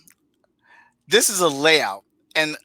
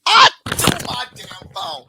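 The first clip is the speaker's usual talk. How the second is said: ordinary talk, nothing marked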